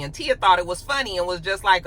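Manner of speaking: ordinary delivery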